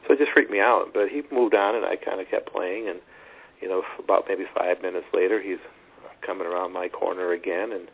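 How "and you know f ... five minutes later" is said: ordinary talk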